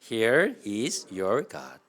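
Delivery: loud, very slow English reading; slow read-along. Shown as a demonstration. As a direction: lead the group word by word, projecting. Leads congregation reading English verse. (천천히 크게 따라 읽히는 영어 낭독)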